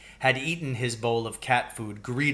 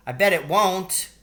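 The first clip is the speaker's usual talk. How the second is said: put-on high, louder kid's retort; defiant child; 7 semitones higher, 5 dB louder than usual. A narrator's reading. Billy contradicting his brother, high defiant. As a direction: shoot back like a stubborn little boy.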